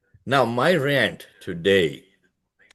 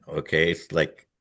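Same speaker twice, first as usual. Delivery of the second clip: normal talking voice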